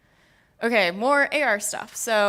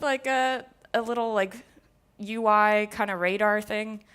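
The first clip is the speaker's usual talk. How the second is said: ordinary talk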